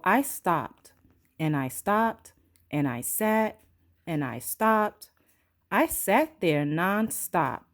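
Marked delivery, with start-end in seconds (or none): none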